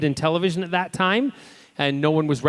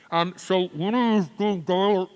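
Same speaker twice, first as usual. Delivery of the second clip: slow, muffled words as if behind a mask; muffled through a mask; 3 semitones higher, slower than usual. Shown as a demonstration. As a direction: talk like your mouth is covered by a mask. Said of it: "it sounded like this".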